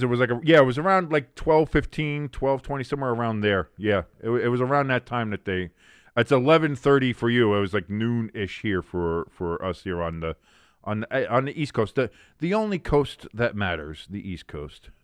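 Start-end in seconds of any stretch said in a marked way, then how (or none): none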